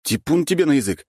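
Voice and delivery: high, exasperated protest; loud, worn-out grumble; exhaled retort. A narrator's reading. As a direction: scold while catching your breath. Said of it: "выдохнул я".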